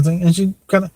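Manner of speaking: ordinary delivery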